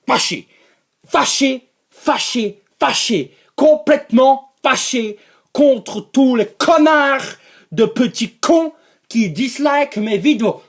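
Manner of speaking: hammed-up anger, word by word; mock angry. Ranting at people who dislike the videos, mock angry. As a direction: repeat it in punched, comic outrage. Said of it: "C'est parce que je suis fâché".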